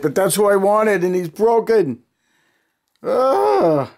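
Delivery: loud, high-pitched lament; dismayed wail. Real, not acted. Collector finds his wanted figure broken, wailing in dismay. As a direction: cry out in real dismay.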